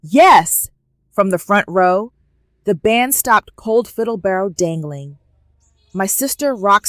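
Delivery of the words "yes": loud narrated cheer; one loud shout of approval; yelling. A narrator's reading. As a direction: yell it out like an excited aunt in the crowd. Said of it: "my aunt yelling".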